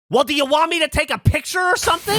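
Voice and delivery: high, irritated snapping offer; mad lady. Retold or imitated. Lady offering to photograph the proposal, mad. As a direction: imitate an annoyed woman offering grudgingly, high-pitched. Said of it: "this lady's mad".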